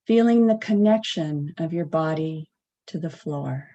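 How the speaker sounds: unhurried meditation guide, long pauses; guided meditation. Acted. Practitioner guiding a sitting meditation at the center, spacious guided delivery. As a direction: guide them gently, leaving space between phrases.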